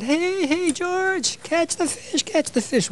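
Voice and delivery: Hey, George high, mock calling out; retold yell. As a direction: call across the river to George. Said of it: "and they yelled".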